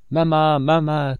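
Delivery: put-on slow, high, loud wail of a beaten child; crying out. A narrator's reading. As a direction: cry out for his mother in pain. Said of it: "cria celui-ci".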